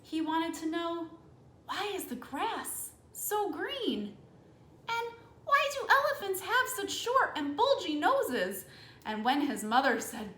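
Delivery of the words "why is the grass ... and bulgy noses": put-on eager child questions. Elephant child asking questions.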